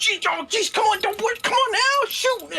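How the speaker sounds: put-on high, cranky old man voice; old codger voice. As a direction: whine like a fussy old codger.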